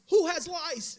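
high loud playful call; translator calling out. Retold or imitated. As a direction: tease the kids with the question. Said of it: "And he says".

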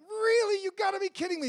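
high, loud squeal of disbelief; thrilled disbelief. Retold or imitated. Woman meeting her music hero, a loud high squeal of disbelief. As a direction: shriek it like a starstruck fan. She spots [name], thrilled.

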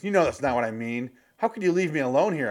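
high, hammed-up complaint; mock whiny wife. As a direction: plead like an indignant wife. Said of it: "And she goes".

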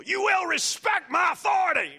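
high, shrieking yell of a bossy leader; mock authoritarian scream. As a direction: scream it like a petty tyrant demanding respect. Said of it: "whenever you have to scream at people".

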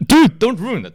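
loud, high-pitched protest; mock outrage. Real, not acted. Host to co-host who broke the dramatic pause, indignant outburst. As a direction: scold a friend who spoiled your moment.

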